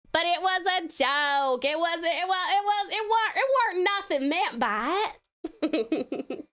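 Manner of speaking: drawn-out country Southern excuse; Southern accent. Pretend. Streamer excusing a joke to viewers, Southern accent. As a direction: plead innocence in a Southern drawl. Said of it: "Was that southern enough?".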